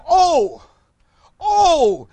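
high, drawn-out "Oh" from the pulpit; exclamatory. Acted. Preacher reads David's psalm to congregation, exclaiming. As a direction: cry it out as with an exclamation mark. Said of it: "It is an exclamation mark".

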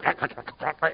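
wordless crackly wheezing croak; imitated emphysema rasp. Imitating the voice over the speaker, crackly emphysema croak. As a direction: croak like a worn-out smoker's voice. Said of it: "sort of one of these emphysema female voices".

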